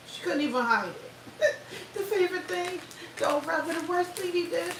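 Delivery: words through a laughing fit; can't hold the laugh in. Real, not acted. Laughing fit while talking.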